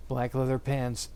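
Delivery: ordinary delivery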